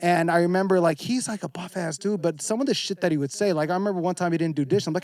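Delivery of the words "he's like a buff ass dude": normal talking voice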